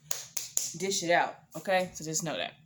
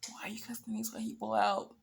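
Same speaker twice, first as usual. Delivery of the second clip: whispered; 3 semitones higher, 7 dB quieter than usual. Retold or imitated. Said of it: "I was, like, cringing".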